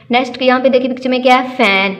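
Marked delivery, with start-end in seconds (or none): none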